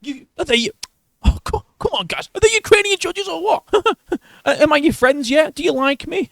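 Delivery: very high whiny mock voice; shrill high parody of a sycophant; mocking fawning reporter. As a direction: squeak it like a suck-up. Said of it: "Just a salty motherfucker".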